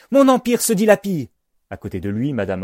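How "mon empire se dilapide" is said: high, loud, alarmed lament; crying out. A narrator's reading. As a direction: shout it as if all is being lost. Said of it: "s'écrie-t-il".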